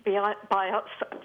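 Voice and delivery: real stumble over the first syllables; stuttering. Real, not acted. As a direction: trip on the word and restart it. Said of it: "sorry, I'm stuttering now".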